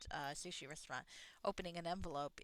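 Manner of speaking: ordinary delivery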